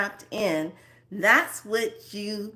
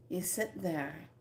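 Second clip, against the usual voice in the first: ordinary delivery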